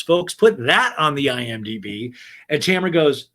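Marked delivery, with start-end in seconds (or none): none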